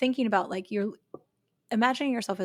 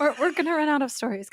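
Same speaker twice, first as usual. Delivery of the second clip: laughing; 6 semitones higher, faster than usual. Real, not acted. Joking plea for listener stories.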